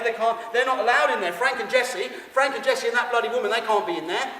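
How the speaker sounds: loud, high, insistent chant; manic ranting. Pretend. Showman rants that they can't be in there, loud and manic. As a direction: hammer each they can't.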